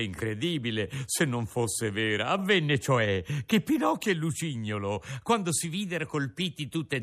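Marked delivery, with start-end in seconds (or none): none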